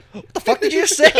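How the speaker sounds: high, loud outraged mom; angry mom. Retold or imitated. Mom catches son cussing, loud outraged high scolding.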